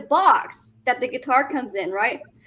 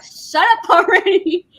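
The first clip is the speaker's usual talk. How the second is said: slow, louder, higher mock yell; raised voice, stretching the words; retold yell; 5 semitones higher, 5 dB louder than usual. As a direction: re-enact an exasperated yell, drawn out. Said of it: "I'm sorry I yelled at you".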